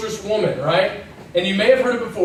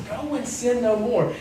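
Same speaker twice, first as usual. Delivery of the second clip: quiet; 3 semitones higher, 5 dB quieter than usual. Shown as a demonstration. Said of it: "He says".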